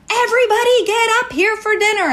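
the third time, losing patience; mad yell. Retold or imitated. Mom yelling family up for dinner, angry. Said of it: "now I'm mad".

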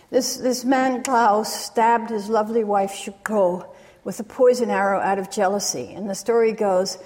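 ordinary talk, nothing marked

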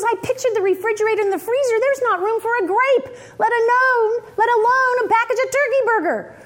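high, hurried justification; son's earnest excuse. Retold or imitated. Son justifying his refusal to his mother, high and earnest.

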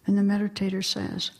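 ordinary talk, nothing marked

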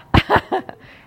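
high, loud burst of laughter. Real, not acted. Laughs at the laundry story.

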